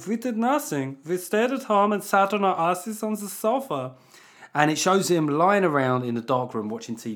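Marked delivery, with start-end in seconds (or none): 0.1-3.9 higher, put-on German accent for the old man. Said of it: "I've got to do the accent".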